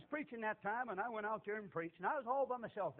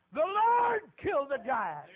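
big, slow, loud pulpit cry; loud, slow preacher's boom; booming declaration; 6 semitones higher, 13 dB louder, slower than usual. Retold or imitated. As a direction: proclaim it to the back row. Preacher quoting bold declaration.

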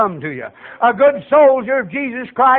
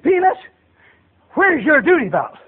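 lightly voiced sergeant, raised pitch on the name; sergeant's challenge; 6 semitones higher than usual. Sergeant questions recruit about his duty belt, lightly acted challenge.

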